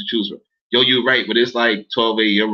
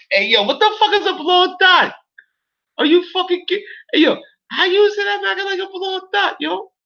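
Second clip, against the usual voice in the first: very high, laugh-choked squeal; incredulous laughter. Real, not acted. Streamer protesting a teasing comment, squealing with laughter. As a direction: protest while cracking up. Cracks up at the insult.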